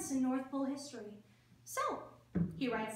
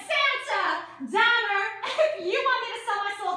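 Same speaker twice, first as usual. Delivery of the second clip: raised, higher voice, calling them out; indignant confrontation; 4 semitones higher, 6 dB louder than usual. Acted. Reindeer walking out on Santa, loud and indignant. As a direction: fire off each name loudly.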